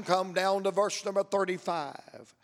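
ordinary delivery

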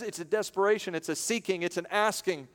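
normal talking voice

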